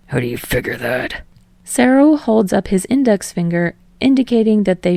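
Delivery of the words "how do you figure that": put-on lower, scornful young man's voice; surly Bakugo. A narrator's reading. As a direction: grumble it down at the manager. Bakugo challenges Sero.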